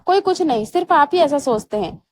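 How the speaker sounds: usual speaking voice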